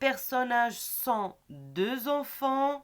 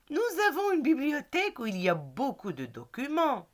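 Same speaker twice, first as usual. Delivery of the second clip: clear, measured classroom read-aloud; reading a dialogue aloud. Acted. Teacher reading the Hakim and Rachid dialogue to pupils.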